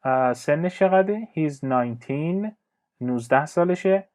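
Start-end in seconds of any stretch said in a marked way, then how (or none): 1.4-2.5 slow model reading. Shown as a demonstration. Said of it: "teacher models answer".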